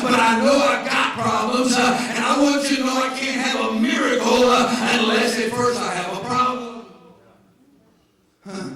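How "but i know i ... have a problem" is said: loud, slow, driving sermon cadence; full-force preaching. Acted. Pastor preaching at full volume to the congregation.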